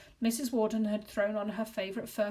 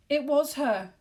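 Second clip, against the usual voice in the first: grim agreement; 6 semitones higher, 9 dB louder than usual. Acted. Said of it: "Mister Warden agreed".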